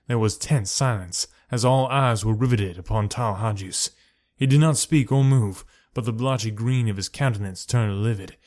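ordinary delivery, nothing marked

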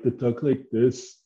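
lightly put-on German accent; a touch of mock German accent. Showing how people from Germany talk, mock German accent. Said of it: "do a German accent".

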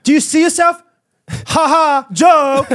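high, loud hammed-up mockery; mock sassy taunt. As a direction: laugh it at them, over the top.

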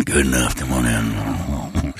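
low, very slow leering voice; creepy low drawl. Acted. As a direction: draw it out like a creep. Acting creepy predator.

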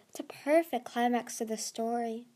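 ordinary delivery, nothing marked

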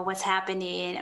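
normal talking voice

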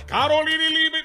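high mock voice. Mocking the influencer's name.

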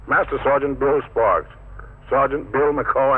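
ordinary talk, nothing marked

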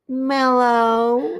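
high meowed greeting; cat saying hello. Shown as a demonstration. Imitating the cat that learned to say hello, high meowing voice. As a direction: blend a meow into a hello, high-pitched. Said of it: "That sounded like a cat saying hello".

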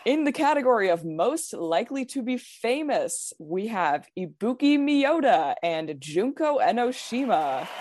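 measured, dramatic awards announcer voice. Pretend. Reading out the final superlative results to friends, dramatic announcer voice. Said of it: "dramatic announcer voice?".

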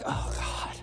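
weary whispered groan; bored groan. Retold or imitated. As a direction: breathe it out like a bored sigh. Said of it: "It's like".

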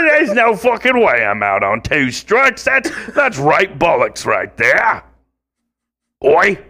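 high, hammed-up British accent; over-the-top Cockney rant; mock British accent. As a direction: rant like an offended Brit. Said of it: "that's kinda British".

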